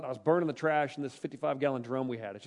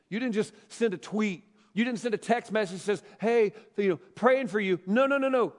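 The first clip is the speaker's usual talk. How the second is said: preaching at full force; 7 semitones higher, 9 dB louder than usual. Acted. Building preaching climax.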